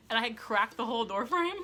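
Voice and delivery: laughing through the words; cracking up while telling. Real, not acted.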